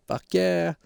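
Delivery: lightly voiced tough guy cheering; Mark Wahlberg impression. Mark cheering the narrator on, lightly voiced tough guy. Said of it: "He's like".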